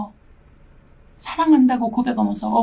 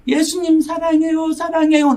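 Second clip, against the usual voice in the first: reliving her tearful prayer to Jesus; 7 semitones higher than usual. Retold or imitated. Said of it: "눈물이 빗물이야".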